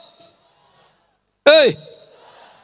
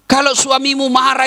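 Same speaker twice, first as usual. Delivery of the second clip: ordinary talk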